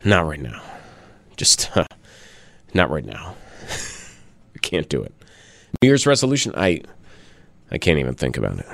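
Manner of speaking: low, quivering, defeated coach voice; Bill Belichick. Retold or imitated. Host mocking losing coach's answer to reporter, quivering low voice. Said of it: "like, quivering voice?".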